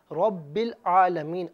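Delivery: teacher's slow, loud recitation; slow recited demo. Shown as a demonstration. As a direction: recite رب العالمین slowly and clearly. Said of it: "ہم آپ کو بتائیں گے کہ ملائیں گے کس طرح".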